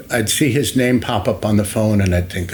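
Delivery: ordinary delivery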